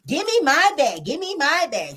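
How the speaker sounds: high-pitched mock insistence; acted demanding. Kimmy dancing for a gift bag, high mock demanding.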